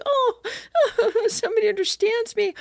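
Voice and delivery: high-pitched sobbing imitation breaking into laughter; mock crying. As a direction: wail it tearfully, half laughing at yourself. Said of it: "I was crying because I was like".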